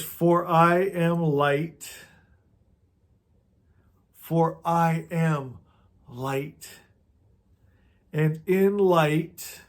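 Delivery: each word of "For I am light" drawn out slowly; solemn slow recitation; slow emphatic reading. Shown as a demonstration. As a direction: read the spell slowly, leaning on "I". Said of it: "It says".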